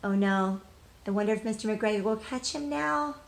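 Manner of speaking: normal talking voice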